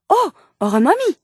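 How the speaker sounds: excited. Acted. Eager guess about a museum exhibit.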